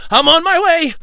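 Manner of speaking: shouted from far away; distant shout. Acted. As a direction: yell it while running up stairs. Shouting while climbing stairs.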